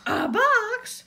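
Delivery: high, excited shout; SpongeBob. A narrator's reading. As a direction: squeal it out loudly. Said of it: "shouted SpongeBob".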